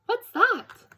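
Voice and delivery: high, curious cub question; little cub voice. Acted. Little cub asks grandpa, high and curious. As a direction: squeak it up like a small bear.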